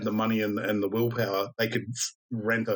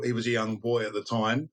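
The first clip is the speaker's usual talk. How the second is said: ordinary talk, nothing marked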